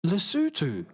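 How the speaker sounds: "Lesotho" as luh-SOO-too; actual pronunciation. Shown as a demonstration. Streamer learning the real name, careful "Lesotho", luh-SOO-too. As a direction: practise "Lesotho" with two OO vowels, falling. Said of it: "That sounds weird".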